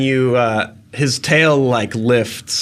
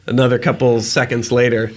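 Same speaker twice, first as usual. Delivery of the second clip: normal talking voice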